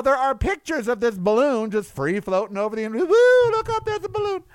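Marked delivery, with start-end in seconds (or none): 2.9-4.4 high, loud whoop settling into high-pitched awe; mock wonder. Onlookers pointing at a balloon floating overhead, mock wonder. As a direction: call out like a gawker spotting something overhead.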